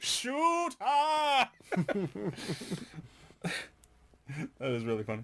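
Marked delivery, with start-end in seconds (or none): 0.0-1.5 shouting. Retold or imitated. Mimicking guards in the game.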